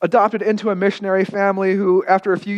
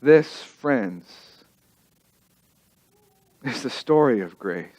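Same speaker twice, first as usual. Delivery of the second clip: hushed sermon close; 8 semitones lower, 4 dB quieter, slower than usual. Real, not acted. Preacher's hushed conclusion.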